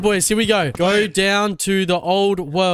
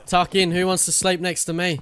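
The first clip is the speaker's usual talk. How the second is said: ordinary delivery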